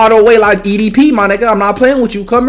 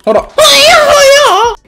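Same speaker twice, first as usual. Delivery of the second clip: crying shout; 14 semitones higher than usual. Real, not acted. Getting knocked down in game.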